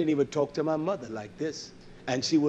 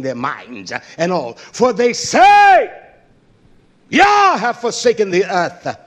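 high, loud preacher's proclamation; fiery preaching; 5 semitones higher, 9 dB louder than usual. Real, not acted. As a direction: build to a shouted prophetic rebuke. Preaches at full force.

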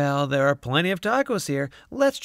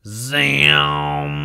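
loud drawn-out zam; zap sound; 3 semitones higher, 7 dB louder than usual. A narrator's reading. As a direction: shout the blast-off. Time machine fires.

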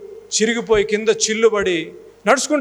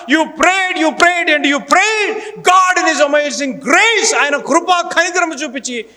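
higher, louder, slow shouted preaching; full-force preaching; 8 semitones higher, 5 dB louder, slower than usual. Acted. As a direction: preach it out, building to a cry. (పూర్తి శక్తితో బోధన)

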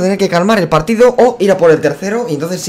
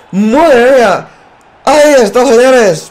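loud, high celebration yell; excited goal shout; 7 semitones higher, 5 dB louder, slower than usual. Real, not acted. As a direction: cheer the goal loudly.

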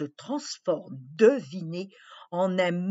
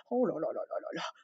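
quick hushed aside; 4 semitones lower, 10 dB quieter, much faster than usual. Real, not acted. Réaction rapide chuchotée.

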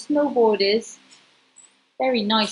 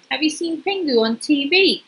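ordinary delivery, nothing marked

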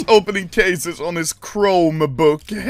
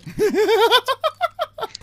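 high-pitched burst of laughter; 14 semitones higher than usual. Real, not acted. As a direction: crack up in high peals. Loud laughter at the red.

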